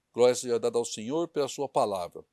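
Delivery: ordinary talk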